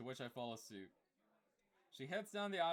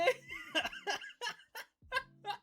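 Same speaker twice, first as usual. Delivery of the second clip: very high, loud squealing laughter; high laughing fit; wheezing laugh; 7 dB louder than usual. Real, not acted. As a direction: lose it completely.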